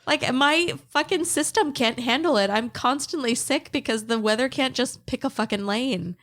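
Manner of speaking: high-pitched comic griping; exasperated rant. Real, not acted. Sick host blaming the Texas weather, exasperated high rant. Ranting about changeable weather.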